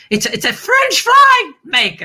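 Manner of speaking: high and loud announcement; excited reveal. Real, not acted. Host reveals the mystery gadget to Don, loud and excited. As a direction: burst out the answer with glee.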